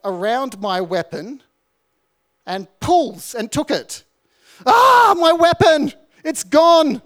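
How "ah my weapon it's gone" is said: high, loud panicked yelp; panicked cry. Retold or imitated. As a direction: cry out in shock at the loss. Recruit discovers weapon stolen.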